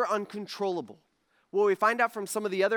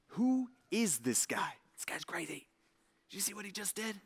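hushed disciples; 8 dB quieter than usual. Retold or imitated. Disciples whispering in the boat.

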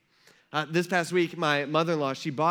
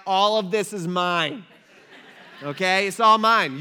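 slow, much louder, laughing it's all mine; mock boasting dad; 4 semitones higher, 8 dB louder, slower than usual. Dad claiming everything from his daughter, loud laughing gloat. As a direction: gloat over your kid with a laugh. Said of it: "the dad talk".

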